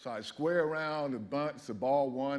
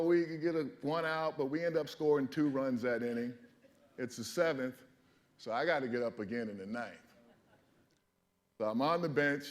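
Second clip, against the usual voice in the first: normal talking voice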